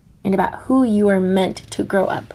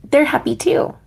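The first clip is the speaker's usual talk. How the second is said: put-on much higher, chirpy childlike voice; happy little penguin; 7 semitones higher than usual. Mumble answering his father, high and gleeful. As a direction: sound like a delighted newborn chick.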